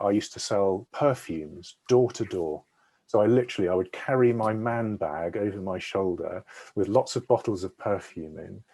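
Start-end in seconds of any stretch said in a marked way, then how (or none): none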